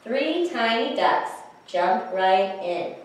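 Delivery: put-on slow, measured, rhythmic reading to children; picture-book rhyme reading. A narrator's reading. Reader shares a duck rhyme with children, slow rhythmic storytelling. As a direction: read each beat deliberately, lifting the sound words. Reads the rhyme's second try.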